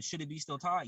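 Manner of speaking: ordinary delivery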